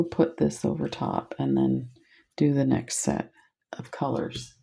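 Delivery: soft whispered aside; murmured whisper to herself. Real, not acted. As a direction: drop to a whisper. Whispered aside while arranging.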